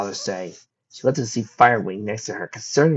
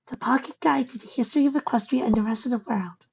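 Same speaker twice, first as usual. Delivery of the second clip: put-on young filly voice. Fireside's voice.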